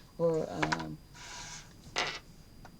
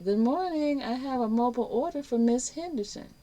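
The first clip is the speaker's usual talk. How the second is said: bright greeting; 9 semitones higher, 4 dB louder than usual. Retold or imitated. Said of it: "I say".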